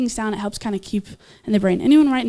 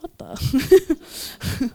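puzzled passerby; 3 semitones higher than usual. Retold or imitated. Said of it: "they're like".

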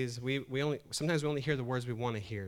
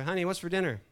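loud, high call to another room; 8 semitones higher, 6 dB louder than usual. Acted. Husband to wife in the kitchen, calling out. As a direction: call out to someone out of earshot.

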